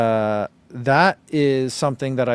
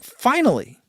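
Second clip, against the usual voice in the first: excited; 9 semitones higher, 4 dB louder than usual. Retold or imitated. Relief at shoe with no clouds.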